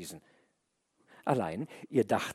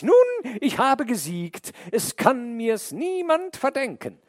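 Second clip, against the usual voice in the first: high, loud crowing snake; gleeful triumph; 14 semitones higher, 8 dB louder than usual. A narrator's reading. The delighted snake gloats to the man, high and loud. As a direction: crow over the win. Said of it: "Da freute die Schlange sich höchlich".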